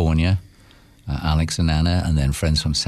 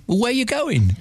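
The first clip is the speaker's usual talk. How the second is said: mock-exasperated, much higher pitch; exasperated driver. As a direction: ask it in disbelief, voice jumping up high. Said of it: "he looked at her and he said".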